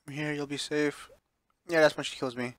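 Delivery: ordinary talk, nothing marked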